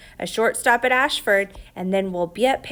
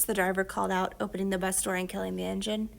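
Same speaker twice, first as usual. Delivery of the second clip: usual speaking voice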